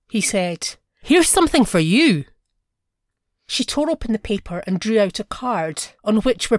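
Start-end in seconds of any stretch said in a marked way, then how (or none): 1.0-2.2 higher, louder, proud announcement; triumphant offer. A narrator's reading. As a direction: hand it over in triumph. Husband shows invitation triumphantly.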